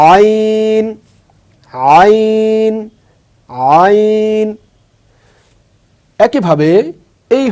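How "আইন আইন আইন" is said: আইন drawn out long and high from the throat; teacher sounding out আইন; letter name demo. Shown as a demonstration. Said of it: "এই হরফটার নাম হচ্ছে".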